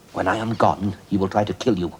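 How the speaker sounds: normal talking voice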